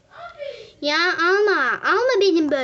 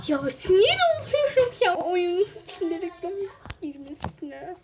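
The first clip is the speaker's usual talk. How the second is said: ordinary talk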